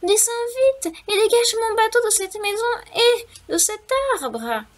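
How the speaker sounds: high, eager mouse voice; little mouse voice. A narrator's reading. Mouse begging the wind for help, high and squeaky. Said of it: "s'écria le souriceau".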